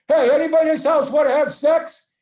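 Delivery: loud, high mock yell to the whole house; yelling through the house. Coming home late, he yells to wake the household, loud mock yell. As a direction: bellow it up the stairs to wake everyone. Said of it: "the next thing I yell is".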